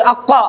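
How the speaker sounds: heavy, full-mouthed emphatic consonant in अक्का; मोटी आवाज. Shown as a demonstration. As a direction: say अक्का thick and rounded, towards "aw". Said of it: "मोटा करेंगे". (अक्का को मोटा, भरी आवाज़ में)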